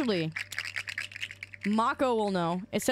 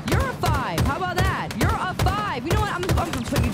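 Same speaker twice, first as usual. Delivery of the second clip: raised, higher-pitched comeback; heated retort; 5 semitones higher, 4 dB louder, faster than usual. Real, not acted. Streamer turning the accusation on chat, heated. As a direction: throw it back louder and higher.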